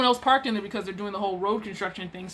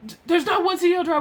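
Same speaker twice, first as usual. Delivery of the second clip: imitating a heated contradiction, high and fast; mock angry denial; 7 semitones higher, 3 dB louder, faster than usual. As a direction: cut in and deny it heatedly. Coworker contradicts her in retold quarrel.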